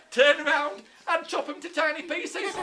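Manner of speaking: high, loud hammed-up war shout; mock battle cry. Teacher plays the French springing their trap, gleeful battle cry. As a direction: yell the order gleefully to the troops.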